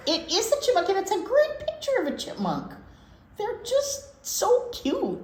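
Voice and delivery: high, gushing delight over a cute picture. Real, not acted. Host showing radio co-host a picture, high delighted gushing.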